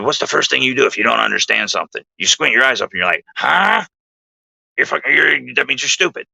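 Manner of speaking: fast, odd put-on voice with a high mock huh; imitating Bill. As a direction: rattle it off in the buddy's strange voice, jumping up on huh. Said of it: "I'm gonna do my best to imitate Bill's voice".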